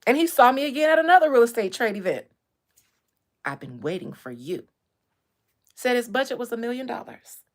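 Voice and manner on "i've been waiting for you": creepy quote. Retold or imitated. Client's line again.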